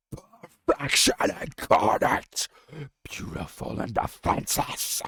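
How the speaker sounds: loud rasping howl of a grieving dragon; half-words torn from a howl; howling grief. Acted. Deathwing howls in grief and rage. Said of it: "words almost unintelligible as Deathwing howled".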